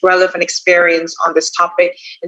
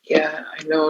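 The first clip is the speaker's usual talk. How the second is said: much softer, flat and bored-sounding; unenergized answer; 2 semitones lower, 8 dB quieter than usual. Shown as a demonstration. Teacher shows a dull exam answer, bored and much softer. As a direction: mumble it like you couldn't care less. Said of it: "different when I say something like this".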